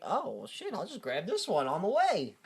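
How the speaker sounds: soft offhand troll mutter; mock troll. Friend imitates the lazy troll grabbing kids, soft shrug. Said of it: "every time he's like".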